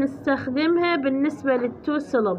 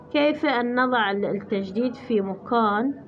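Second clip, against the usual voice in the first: ordinary talk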